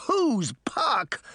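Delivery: put-on high, incredulous boy voice; outraged cry. A narrator's reading. Boy insulted by girl's question, crying out. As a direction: echo it back as an affronted cry. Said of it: "the boy cried".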